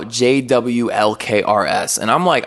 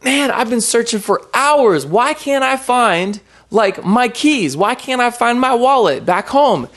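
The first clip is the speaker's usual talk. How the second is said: higher, slowed-down whiny complaint; frustrated searching; 11 semitones higher than usual. Retold or imitated. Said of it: "I was like".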